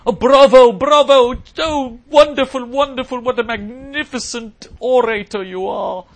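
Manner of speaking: high, loud, gushing British praise; hammed-up British accent, slow and effusive; mock British accent. Host imitates Manhattan listeners applauding, mock British gushing. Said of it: "pretend that they're British".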